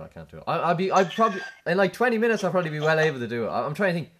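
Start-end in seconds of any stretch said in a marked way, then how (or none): none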